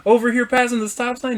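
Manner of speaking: high-pitched complaint at normal volume; retold indignant venting. Driver venting to a friend by phone, retold indignantly. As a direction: act out griping on the phone.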